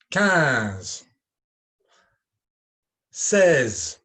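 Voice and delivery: numbers called out loud, slow and clear; loud teacher drill. Shown as a demonstration. Teacher calling tricky numbers for pupils to repeat. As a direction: announce each number for the class to echo. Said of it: "écoutez et répétez".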